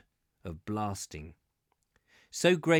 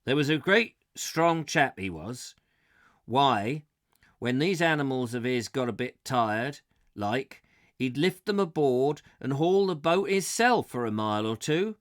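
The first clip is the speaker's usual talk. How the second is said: put-on boatman's West Country accent, louder and livelier; 2 semitones higher, 4 dB louder than usual. Boatman in the inn brags of a legend, West Country accent. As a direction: tell the strongman tale in a West Country burr. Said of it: "I'm doing it in a West Country accent".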